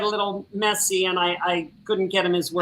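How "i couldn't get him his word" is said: ordinary talk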